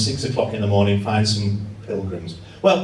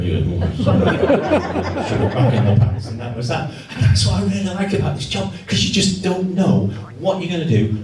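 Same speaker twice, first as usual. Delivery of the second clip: usual speaking voice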